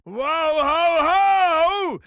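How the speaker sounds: high, rolling "whoa-ho-ho"; big theatrical hoot; showman's whoop. Acted. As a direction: let out a long, high whoop. Host whoops at coin toss.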